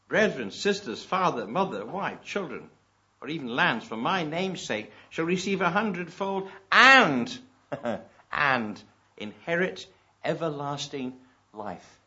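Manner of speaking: loud, slow proclaiming of scripture; slow preaching read. Acted. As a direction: draw the promise out slowly. Preacher reads scripture, slowing.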